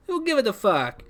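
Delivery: put-on high-pitched, clumsy Italian accent; badly faked Italian accent. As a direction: attempt an Italian accent in a character voice. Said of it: "I can't do an Italian accent".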